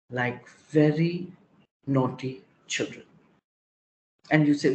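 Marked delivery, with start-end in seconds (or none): none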